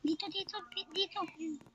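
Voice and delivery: soft hushed whisper. Real, not acted. Hiding from teacher in dark.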